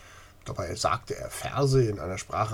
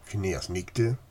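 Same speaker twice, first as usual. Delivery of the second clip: ordinary delivery, nothing marked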